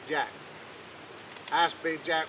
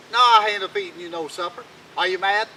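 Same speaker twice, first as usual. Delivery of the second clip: higher and louder, goading; taunting king; 3 semitones higher, 9 dB louder than usual. Acted. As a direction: goad him into losing his temper.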